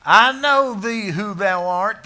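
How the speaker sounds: loud, high and slow, leaning on know; thundering scripture line; loud quote. Shown as a demonstration. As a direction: proclaim it slowly and loud. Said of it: "Thank you. I can see that sign".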